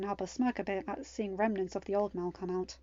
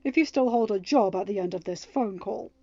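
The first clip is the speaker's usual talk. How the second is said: near growl; 10 dB louder than usual. A narrator's reading. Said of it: "his voice still near a growl".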